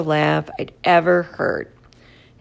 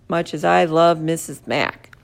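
ordinary delivery, nothing marked

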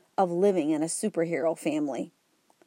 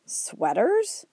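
surprised; 6 semitones higher than usual. Acted. Boy stunned by silly power.

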